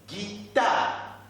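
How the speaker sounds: "guitare" as ghee, then a long tar; syllable by syllable. Shown as a demonstration. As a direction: sound out "guitare" in separate beats for learners. Teacher repeats word in syllables.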